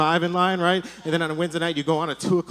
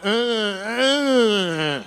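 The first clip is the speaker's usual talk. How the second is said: stuck and helpless, mock groans; helpless grunts; 3 semitones higher than usual. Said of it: "laying there like an idiot going".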